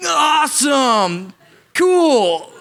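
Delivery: high, slow, loud hammed-up awe; fake enthusiasm. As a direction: stretch it out in mock amazement.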